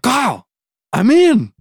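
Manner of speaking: excited announcement. Retold or imitated. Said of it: "I call Kyle and I'm like".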